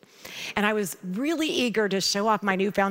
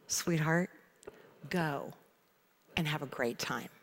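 tender father; 4 semitones lower, 9 dB quieter than usual. Retold or imitated. Father gently handing over keys.

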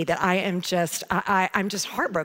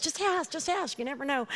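much higher, quieter pleading friend voice; higher, softer insisting teen friend; friend Kathy; 7 semitones higher, 5 dB quieter than usual. Retold or imitated. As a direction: coax her in a high wheedling voice. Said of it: "And she goes".

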